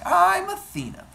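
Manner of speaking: high, loud self-announcement; bold introduction. Acted. Athena introduces herself to Hera.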